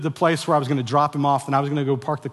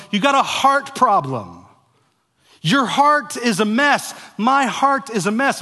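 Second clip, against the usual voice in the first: preaching at full force; 9 semitones higher, 7 dB louder than usual. Acted. Pastor preaching to his congregation, full force. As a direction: hammer the point home to the congregation.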